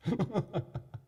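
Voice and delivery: high breathy chuckle; a hollow little laugh; eerie soft laugh. A narrator's reading. Chained Fortunato laughing softly, high and eerie. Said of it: "a sad voice".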